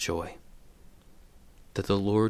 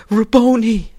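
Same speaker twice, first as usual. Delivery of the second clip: a high, loud cry of joy; Mary crying out in sudden recognition; joyful cry; 14 semitones higher, 20 dB louder than usual. Acted. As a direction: call out to the teacher you thought dead. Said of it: "she cried".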